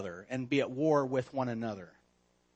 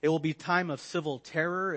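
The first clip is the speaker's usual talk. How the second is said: ordinary talk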